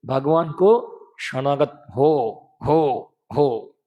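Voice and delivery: very slow, stretching every syllable; हो, हो dragged out long and slow; drawn out. Retold or imitated. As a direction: pull each word long like a pleading call. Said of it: "खींच खींच कर बोलते हैं".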